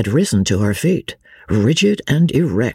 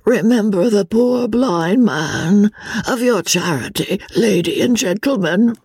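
feeble beggar; 8 semitones higher than usual. A narrator's reading. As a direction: beg feebly as a blind man. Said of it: "alternate more feeble tones".

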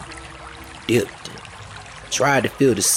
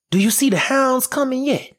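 put-on much higher, small frightened girl's voice; little girl's voice. Girl in the tree asking her brother, high and anxious.

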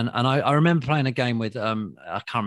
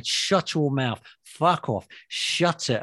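raised-pitch imitation of barked brush-offs; brusque dismissal; 6 semitones higher, much slower than usual. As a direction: punch out each retort, hammed up. Acting out Winston rebuffing cheering fans.

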